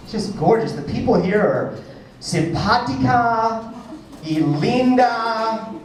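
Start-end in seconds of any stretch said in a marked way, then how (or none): 2.2-5.7 slow, lingering Spanish compliments; drawn-out Spanish flattery. Acted. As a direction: stretch each Spanish word out admiringly. Switches into Spanish to flatter crowd.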